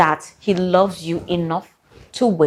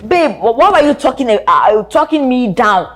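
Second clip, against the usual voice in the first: put-on hurt girlfriend; 5 semitones higher, 4 dB louder than usual. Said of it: "when you're like".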